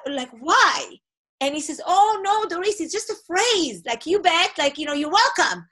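ordinary talk, nothing marked

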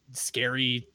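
ordinary talk

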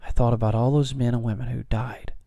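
quiet, emotion welling up in the throat; choked up. Real, not acted. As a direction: hold back tears as you speak softly. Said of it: "I'm getting a little choked up".

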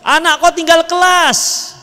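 shrill nagging yell. Pretend. Wife yelling at husband at the gate, loud mock nagging. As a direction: blurt it out at him the moment he walks in. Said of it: "jangan teriak".

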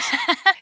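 a brief high giggle; short laugh. Real, not acted. Giggling at his own height with boots on.

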